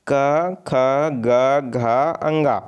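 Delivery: क, ख, ग, घ each sounded separately from the throat; teacher sounding out throat consonants क, ख, ग, घ; pronunciation demo. Shown as a demonstration. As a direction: recite क, ख, ग, घ, अंगा slowly, one by one. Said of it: "क वर्ग का जो उच्चारण है".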